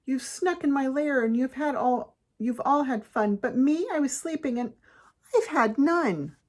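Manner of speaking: high, put-out bear complaint; wailing bear. A narrator's reading. Bear complains to party guests in his lair, wailing. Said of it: "he whimpers and he moans and he wails".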